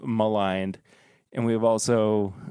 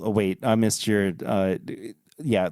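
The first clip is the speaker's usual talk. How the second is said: usual speaking voice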